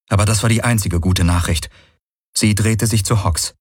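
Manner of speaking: usual speaking voice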